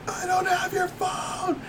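high, drawn-out yell held back in volume; retold angry yell. Clerk yells at his accuser, retold angry shout. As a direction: re-enact a full-anger yell, stretching the words. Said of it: "the second I yelled at, like, full anger".

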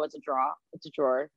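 "drawer" in one syllable, no sounded W; own drawer pronunciation. Retold or imitated. Correcting the others' version, plain one-syllable drawer. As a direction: say "drawer" short, like "draw". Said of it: "they emphasize the W".

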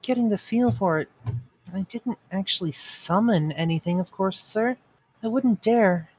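uncertain, faltering. A narrator's reading. Apprentice denies summoning to his master, mildly uncertain. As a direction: make a hesitant excuse, a touch timid. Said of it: "He began in an uncertain voice".

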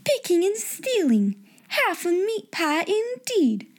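slow high scolding; stern headmistress. A narrator's reading. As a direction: lay down the sentence coldly. Miss Minchin scolds Becky.

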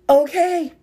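high, loud quick okay; startled okay. Retold or imitated. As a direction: blurt it out in surprise. Answering the Holy Spirit.

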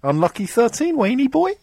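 gloating sing-song, drawn out and high; sing-song taunt. Acted. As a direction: taunt him like a playground singsong, climbing in pitch. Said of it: "teasing Wayne about losing his".

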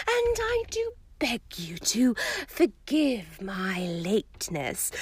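airy mystic, slow and dreamy; misty seer. A narrator's reading. Seer explaining her late arrival, dreamy and airy. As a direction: float the words like a prophecy.

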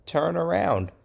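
nervous people propose retreat; hesitant high plea; mock suggestion. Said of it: "And the people say".

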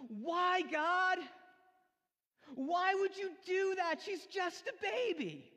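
put-on high, loud, slow anguished child's cry to God; imitating a child's wail. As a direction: cry it out like a grieving little girl. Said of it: "wailing, and she starts stomping".

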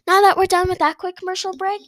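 loud, high announcer voice; game show host. Acted. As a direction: announce it to the crowd. Show host returns from break.

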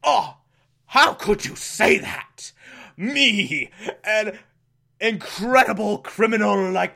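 hammed-up offended villain; the Minstrel. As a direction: raise the pitch and stretch out the indignation. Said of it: "the minstrel's like".

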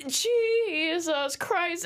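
very slow, stretched sigh of a curse; drawn out exasperation. Retold or imitated. Singer standing up with no call ready, exasperated. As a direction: drag it out, resigned. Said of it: "and I'm like".

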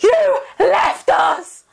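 guilt-trip the friend who ran; accusing shout. Retold or imitated. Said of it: "Sinead was like".

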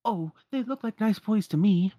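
high, lilting character voice; kindly servant. Acted. Hidden servant vouching for the visitors, high and kindly. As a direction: reassure the others in a high voice.

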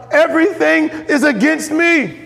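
high, drawn-out cry of despair; anguished cry. Acted. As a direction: cry it out slowly, as a despairing father. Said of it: "And then he cries out".

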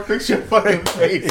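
stern order. Pretend. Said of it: "you tell your girl".

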